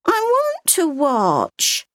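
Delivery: put-on high, slow small-child voice; a curious little girl asking; little girl's voice. A narrator's reading. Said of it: "she said".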